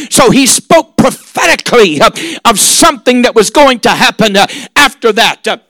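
ordinary talk

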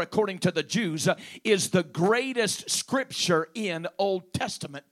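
loud, driving preacher delivery; forceful preaching. Real, not acted. Preacher drives the greatest attribute of God into the congregation, forceful preaching. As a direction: preach it hard, building in volume and pitch.

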